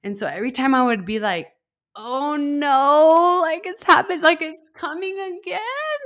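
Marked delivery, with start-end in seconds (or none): 1.9-6.0 high, drawn-out mock wail; retold dread. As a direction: wail it in dread, re-enacting the moment. Reliving dread as contractions return.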